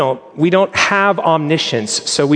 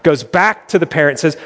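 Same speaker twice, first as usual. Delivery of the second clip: ordinary delivery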